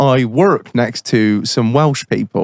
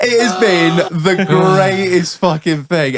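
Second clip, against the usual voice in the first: normal talking voice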